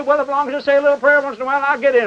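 high, quick mock self-assurance; glib excuse. Preacher mimicking people who go through ritual, glib. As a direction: rattle it off high, mimicking a careless churchgoer. Mocking complacent churchgoers' excuse.

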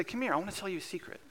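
put-on crouching down to a toddler, speaking very softly. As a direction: coax a toddler close, softly and fast. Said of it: "I speak very softly".

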